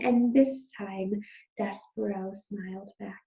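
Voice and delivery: plain reading voice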